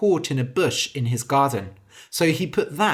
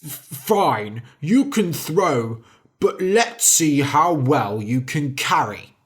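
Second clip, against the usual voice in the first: angry giant. A narrator's reading. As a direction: spit it out in anger. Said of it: "said angrily".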